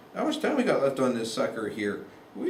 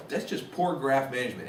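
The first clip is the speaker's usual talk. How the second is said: normal talking voice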